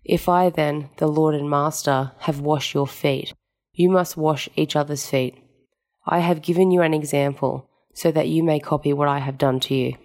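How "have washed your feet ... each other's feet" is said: normal talking voice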